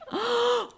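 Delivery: high sudden gasp of fright; startled gasp. Acted. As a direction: gasp like you got a scare. (깜짝 놀란 헉 소리)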